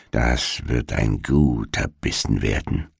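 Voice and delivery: low, soft greedy mutter; muttering to herself. A narrator's reading. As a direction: murmur it under your breath, savouring the thought. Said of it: "murmelte sie vor sich hin".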